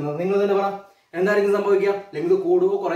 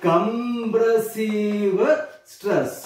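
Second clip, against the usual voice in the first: Compressive drawn out very slowly, rising at the end; dictating slowly; much slower than usual. Shown as a demonstration. Teacher to students repeating the term, very slow dictation. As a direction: draw the word out like dictation.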